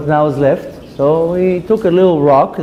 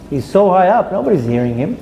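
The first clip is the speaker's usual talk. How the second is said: ordinary talk, nothing marked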